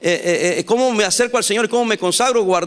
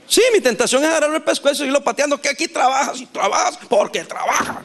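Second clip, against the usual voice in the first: mock-exasperated rush, easing off at the end; vehemencia exagerada; 5 semitones higher than usual. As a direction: blurt it out fast and high, hamming up the exasperation. Repite con vehemencia su tentación fingida. (vehemencia exagerada, atropellada y en broma)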